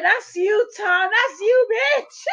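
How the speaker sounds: high, laughing taunt; teasing through laughter. Retold or imitated. Wife teases her husband through laughter, pitched high. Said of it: "I was like".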